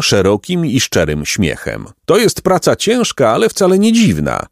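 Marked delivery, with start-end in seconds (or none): 2.0-4.5 put-on high, bright-hearted old peasant voice; cheerful peasant. A narrator's reading. Peasant reassures the two travelling boys, high and kindly. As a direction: play the kindly peasant, pitched up.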